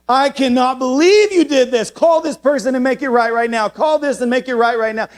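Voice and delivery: high, loud scolding mom; a mother who cannot believe what he did; furious mom. Retold or imitated. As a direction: mom losing it at her son. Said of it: "she lost her marbles".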